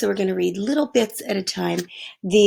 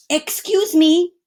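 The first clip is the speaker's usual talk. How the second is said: put-on polite boy; 9 semitones higher, 6 dB louder, slower than usual. A narrator's reading. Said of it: "Arthur said".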